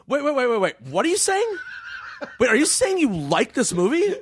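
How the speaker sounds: high, baffled repeated wait, like a cutoff; incredulous interruption. Retold or imitated. Film critic interrupting his guest in mock outrage, high and incredulous. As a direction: cut him off in mock disbelief.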